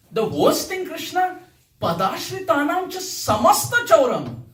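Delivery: high, loud mock indignation; sarcastic accusation. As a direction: accuse God of theft in playful outrage. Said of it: "This whole song is sarcastic".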